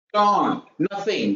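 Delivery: usual speaking voice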